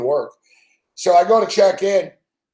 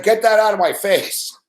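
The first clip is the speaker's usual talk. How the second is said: higher mock dismissal; acted-out brush-off; 5 semitones higher than usual. Agent rejecting his computer, higher mock dismissal. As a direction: imitate a curt rebuff, pitched up. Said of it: "She was like".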